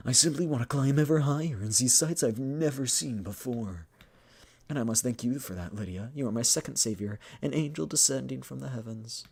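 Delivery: earnest, high-flown character voice; grandiose admirer. Acted. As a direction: declare lofty ambition, then flatter. Voices Carl's grand speech to Lydia.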